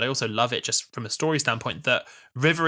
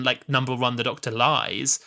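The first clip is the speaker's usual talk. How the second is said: ordinary delivery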